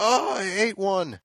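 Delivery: high-pitched, drawn-out mock yelp; mock yell. Retelling the kid finding a jalapeno, mock yell. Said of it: "And he yells out".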